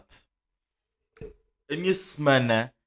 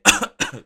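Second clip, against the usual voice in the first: sudden choking cough; tosse engasgada; 9 semitones higher, 7 dB louder than usual. Real, not acted. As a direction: cough as if something went down wrong. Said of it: "isto foi porque eu me engasguei". (tosse de engasgo a sério)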